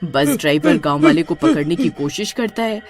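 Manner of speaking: ordinary delivery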